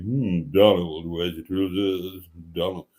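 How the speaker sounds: mock rock-and-roll croon. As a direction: drop it deep and croon it lazily. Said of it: "with a bit of [name] thrown in".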